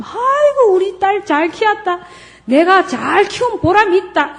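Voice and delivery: proud mother. Retold or imitated. Mother praising daughter.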